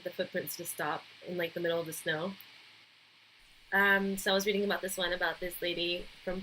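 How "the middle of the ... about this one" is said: ordinary talk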